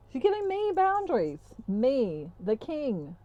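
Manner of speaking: haughty squawk of disbelief, then regal falling tones; indignant. Retold or imitated. Masculine as king affronted by boundaries, mock indignant.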